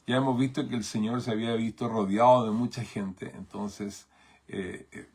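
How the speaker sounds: normal talking voice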